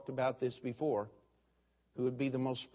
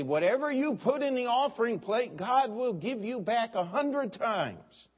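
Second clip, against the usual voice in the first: mock prosperity preacher; 9 semitones higher, 3 dB louder than usual. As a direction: ham up a TV preacher's promise. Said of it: "the preacher would get up and say".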